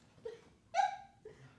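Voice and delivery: high, bright peal of laughter; delighted laugh. Acted. Old lady delighting Roderick, high laugh.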